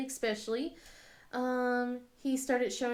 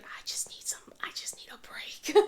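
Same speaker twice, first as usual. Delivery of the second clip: breathless little whisper to herself; worn-out whispered plea; whispered aside; 8 dB quieter than usual. Retold or imitated. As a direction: whisper it like you're desperate for a break. Mom overwhelmed, muttering to herself.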